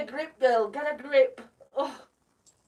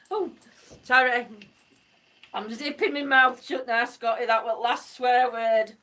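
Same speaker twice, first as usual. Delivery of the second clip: ordinary talk, nothing marked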